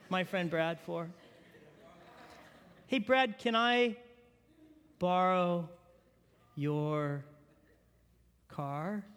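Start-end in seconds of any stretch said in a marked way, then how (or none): 2.8-9.0 slow, stretched, sinking Canadian-less plea; drawn-out ask. Retold or imitated. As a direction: George drags out the request word by word. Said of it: "what George asked".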